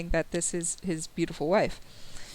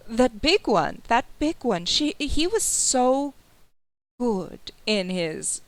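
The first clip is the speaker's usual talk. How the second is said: lightly voiced admiring lady, pitch swooping; a hint of a doting hostess, drawn out; gushing noble lady; 3 semitones higher, slower than usual. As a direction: gush over a guest, stretching the praise. [name] gushes over a guest's look.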